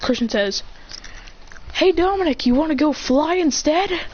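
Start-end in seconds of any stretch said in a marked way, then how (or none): 1.7-4.0 higher, lively call to a friend; eager invitation. Acted. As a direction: pitch it up like a keen suggestion.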